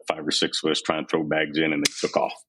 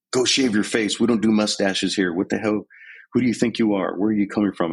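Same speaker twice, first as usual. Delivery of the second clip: higher-pitched mock drill sergeant bark; imitated drill sergeant; 4 semitones higher than usual. Drill sergeant berating an unshaven recruit, imitated higher bark. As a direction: retell the sergeant barking at the recruit, a bit higher.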